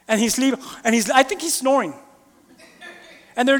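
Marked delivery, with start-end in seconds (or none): none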